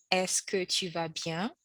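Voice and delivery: teacher repeats it slowly; slow soft repeat. Shown as a demonstration. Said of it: "which is".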